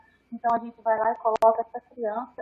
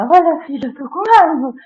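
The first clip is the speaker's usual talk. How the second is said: louder and higher, snapping in anger; angry outburst; 6 semitones higher, 7 dB louder than usual. Shown as a demonstration. Parent yelling at son about being angry. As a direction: yell it at the son. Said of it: "e não é".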